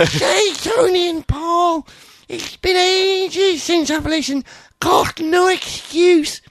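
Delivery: very high, slow shouted read-out; drawn-out mock shout. Host rereads a listener's email, slow high shouting. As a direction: stretch every word out in a high yell.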